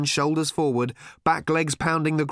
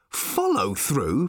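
protesting; 3 semitones higher, much slower than usual. A narrator's reading. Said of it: "protested Arthur".

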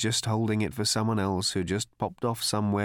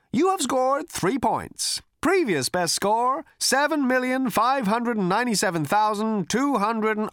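computer announcer voice; 13 semitones higher, 4 dB louder than usual. A narrator's reading. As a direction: read the score like a smug game console.

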